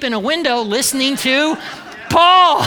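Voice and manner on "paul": Paul blurted much higher and louder; loud punchline. Acted. Preacher joking to congregation, loud comic blurt. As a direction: shout the name as the joke. Punchline, Eutychus asleep listening.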